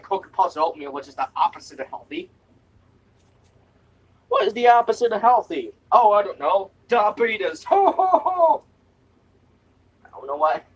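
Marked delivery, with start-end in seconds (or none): 4.3-7.6 hammed-up quiz. Mock question and answer. 7.6-8.6 high jolly ho ho ho, loud; a booming Santa-style ho ho ho; high, loud jolly belly laugh. Acted.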